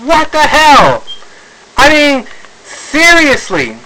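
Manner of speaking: high-pitched, drawn-out incredulous outburst; real exasperation, high and slow; exasperated disbelief. Real, not acted. Ranting in disbelief at Sony.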